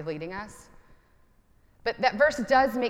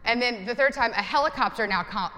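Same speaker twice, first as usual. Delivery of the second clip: ordinary talk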